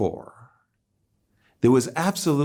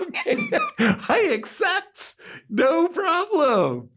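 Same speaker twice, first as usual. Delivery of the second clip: overjoyed acceptance; slower than usual. Retold or imitated. Overjoyed acceptance of paid job.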